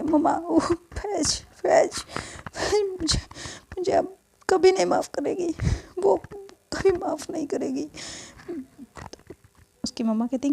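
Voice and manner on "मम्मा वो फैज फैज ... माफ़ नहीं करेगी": put-on a girl crying over losing her friend; crying daughter. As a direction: sob it out to your mother.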